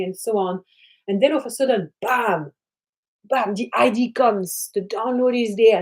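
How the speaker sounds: ordinary talk, nothing marked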